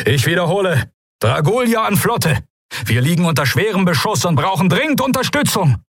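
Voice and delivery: high-pitched shouted radio appeal; urgent distress call. A narrator's reading. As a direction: call desperately for backup under fire. Urgent battle distress call to fleet.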